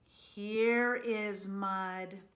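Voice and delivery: loud, very slow, drawn-out delight; blissful relief. A narrator's reading. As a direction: stretch it out with great satisfaction. Pig finds mud at last.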